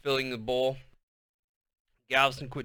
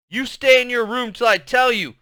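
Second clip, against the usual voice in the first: high, loud angry shout; 10 semitones higher, 8 dB louder than usual. A narrator's reading. Mother shouting after her daughter, angry. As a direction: yell it up the stairs. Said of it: "Clarice shouted after her".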